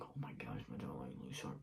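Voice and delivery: very soft, low whisper to oneself; murmured under the breath, barely audible; whispered aside. Real, not acted. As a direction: drop to a hushed, distracted aside. Hushed aside about own looks.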